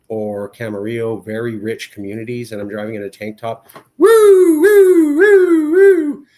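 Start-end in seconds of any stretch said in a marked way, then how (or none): 4.0-6.3 very high, loud siren woo; siren imitation. Police car pulling him over, loud high siren wail.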